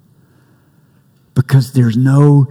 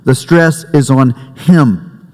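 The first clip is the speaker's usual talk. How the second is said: The stress is on him said slowly and deliberately; slow stressed phrase. Shown as a demonstration. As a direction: spell out The stress is on him word by word. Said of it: "The emphasis of the grammar is squarely on the word".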